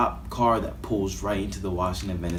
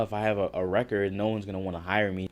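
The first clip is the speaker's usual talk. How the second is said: ordinary talk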